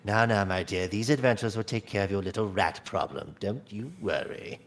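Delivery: low, soft reassurance; soothing. Acted. Georgie comforting the sobbing woman, low and soft. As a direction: hush her gently like a grandfather.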